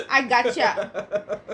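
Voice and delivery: drawn-out mock Bangalore accent. Teasing friend about picking up an accent, slow Bangalore accent. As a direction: slowly imitate a Bangalore accent, amused. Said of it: "come back with a whole Bangalore accent".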